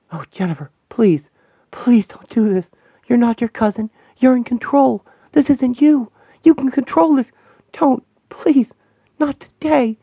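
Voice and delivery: high, rising desperate begging; frightened pleading. Acted. The Wasp begs Jennifer to control herself, frightened. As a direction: plead with her to stop.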